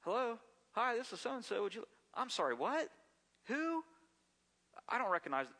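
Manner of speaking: acting out a bewildered caller on the line; mock phone call. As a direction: play a confused person picking up the phone.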